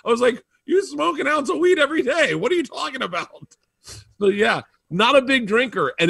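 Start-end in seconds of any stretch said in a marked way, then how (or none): 0.6-3.6 high-pitched, incredulous retort; mock incredulous. Comedian retells challenging [name] about weed, mock incredulous. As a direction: challenge him in acted disbelief, pitched way up.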